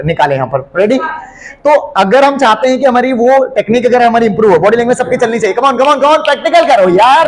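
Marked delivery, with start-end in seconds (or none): 5.6-7.3 urging the class on. Real, not acted. Teacher urging class to join.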